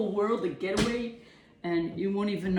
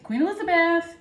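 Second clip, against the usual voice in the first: loud, high call through the door; 10 semitones higher, 7 dB louder than usual. Retold or imitated. Knocking on grandmother's door, loud high call. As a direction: sing out her name.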